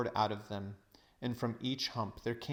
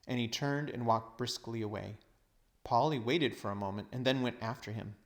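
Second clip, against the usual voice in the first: ordinary delivery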